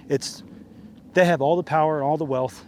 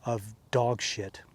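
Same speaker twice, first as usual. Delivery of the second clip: slow contempt; 5 dB quieter, much slower than usual. Real, not acted. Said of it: "just know that you are".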